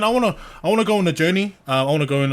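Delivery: ordinary talk, nothing marked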